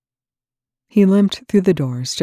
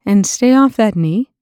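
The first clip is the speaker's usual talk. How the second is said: louder and higher, called across the room; calling after; 7 semitones higher, 9 dB louder than usual. A narrator's reading. Medic calling after departing colonel, loud call. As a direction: call out after him as he leaves. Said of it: "she called after him".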